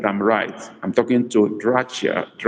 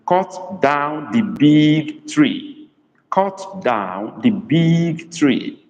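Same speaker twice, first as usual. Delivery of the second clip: very slow, drawn-out, slightly raised pitch; slow dictation; 4 semitones higher, much slower than usual. Shown as a demonstration. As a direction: dictate it word by word for learners. Dictating a sentence to translate.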